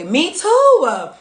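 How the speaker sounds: jumps up high and loud; excited agreement. Real, not acted. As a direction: blurt it out, thrilled to relate. Reacting to viewer's comment.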